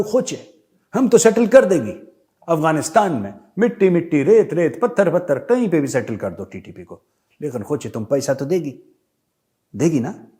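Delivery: Pathan accent bargaining for money, hammed up early then settling. Afghan Taliban asking Pakistan for money, in a mock Pathan accent. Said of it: "वो Pathan accent में इनसे कुछ मांग रहा है".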